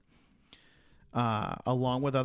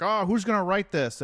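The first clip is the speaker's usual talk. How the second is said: higher, louder mock group voice; founders wondering aloud; 7 semitones higher, 7 dB louder than usual. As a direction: act out old statesmen casting about for a volunteer. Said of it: "they're just like".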